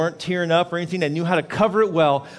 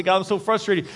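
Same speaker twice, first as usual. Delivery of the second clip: frustrated plea to God, pitched up; voiced frustration; 7 semitones higher, 4 dB louder than usual. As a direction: take a mildly exasperated complaint to God. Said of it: "to be frustrated".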